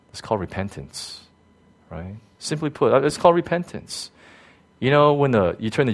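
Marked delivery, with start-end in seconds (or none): none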